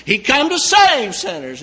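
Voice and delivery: ordinary delivery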